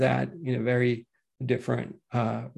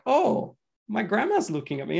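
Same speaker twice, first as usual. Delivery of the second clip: put-on little girl; 8 semitones higher than usual. Grandchild voiced in told story.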